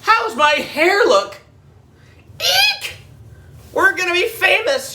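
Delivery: put-on high squealing girl voice; shrieking excitement. A narrator's reading. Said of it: "shrieked Andrea".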